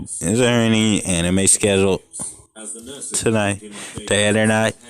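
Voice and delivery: ordinary delivery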